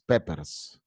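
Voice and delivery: peppers said the Spanish way with a tapped r; Spanish-style peppers. Shown as a demonstration. Teacher shows students Spanish peppers with a tapped r. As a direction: pepers with Spanish vowels and a flicked r. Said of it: "Así como si fuera en español".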